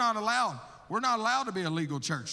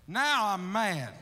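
slow declaration; much slower than usual. Retold or imitated. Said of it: "And I said".

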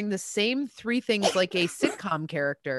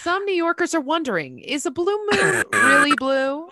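news anchor voice; 10 semitones higher, 5 dB louder than usual. Pretend. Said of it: "An unprepared news anch".

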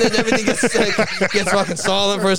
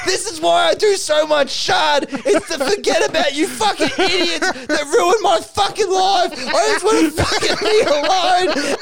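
high-pitched mock tirade; ranting meltdown; 7 semitones higher than usual. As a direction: act out someone cracking and ranting, pitch way up. Duck's meltdown in acted quarrel.

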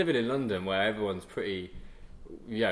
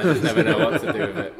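laughing; 4 semitones higher, 5 dB louder than usual. Real, not acted. Laughing about his moustache.

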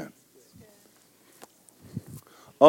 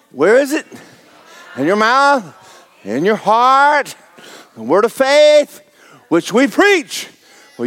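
full-force preaching; 5 semitones higher than usual. Real, not acted.

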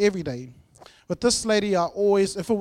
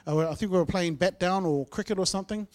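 normal talking voice

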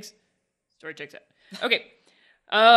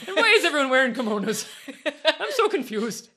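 mock confused tattoo; 3 semitones higher than usual. Voices confused tattoo character.

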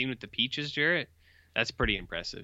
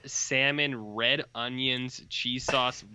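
usual speaking voice

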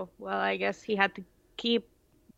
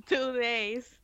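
laughing; 2 semitones higher, 5 dB louder than usual. Real, not acted. Laughing at Franco's beach trip.

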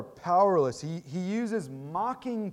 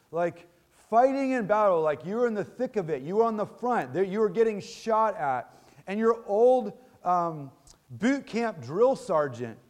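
ordinary delivery

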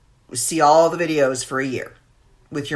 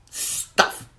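whispered echo. Real, not acted. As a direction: whisper the word Stuff as a breathy aside.

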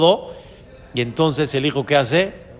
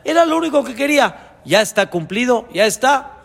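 much higher, urgent call; God speaking to Moses; 5 semitones higher than usual. Retold or imitated. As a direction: urge Moses on as an impatient God. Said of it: "Vayomer Hashem el Moshe".

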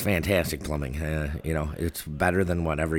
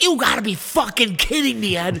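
exasperated; 13 semitones higher than usual. Retold or imitated. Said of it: "I'm like".

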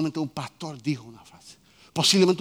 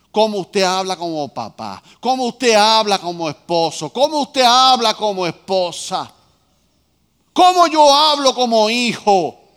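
growing louder with each line; building sermon emphasis; 4 semitones higher, 9 dB louder than usual. Acted. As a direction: drive each phrase harder. Predica con fuerza. (predicación que va subiendo de fuerza)

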